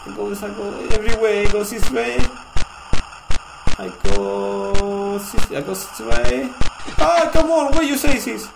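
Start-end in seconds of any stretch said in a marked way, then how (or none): 3.8-5.5 very slow, stretched words, raised pitch; long, loud stretched hesitation; hesitant drawn-out. Real, not acted. Hesitates choosing a path. 7.0-8.5 high, loud complaint; frustrated raised-pitch yell; exasperated outburst. Real, not acted. Frustrated with the game.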